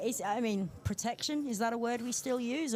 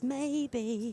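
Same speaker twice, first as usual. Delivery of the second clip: higher, teasing little devil voice; 4 semitones higher than usual. Retold or imitated. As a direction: tempt like a devil on the shoulder. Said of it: "there's a little devil voice that says".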